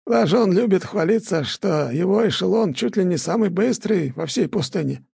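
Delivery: high, creaky village elder; old elder voice. A narrator's reading. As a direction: voice the old man gently. (голос старика)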